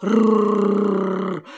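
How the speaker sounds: low rolling growl; dog growl. Acted. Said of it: "зарычал пёс".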